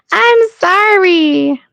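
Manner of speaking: very high, drawn-out "I'm sorry"; high apologetic wail. Real, not acted. Streamer apologizing for a pronunciation attempt, high wailing apology. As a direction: squeal a slow apology through amusement. Laughing apology after failed imitation.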